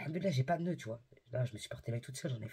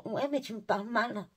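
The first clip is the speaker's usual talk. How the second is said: higher-pitched imitation of an offended buyer; mock complainer; 7 semitones higher than usual. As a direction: mimic someone whining back at you. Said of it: "les gens, ils te disent". (imitation moqueuse d'une cliente vexée)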